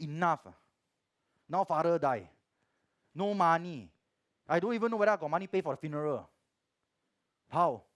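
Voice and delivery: loud, high, pressured complaint; grieving son shouting his complaint; angry at God. Retold or imitated. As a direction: rail at God, words tumbling out. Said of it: "very angry already".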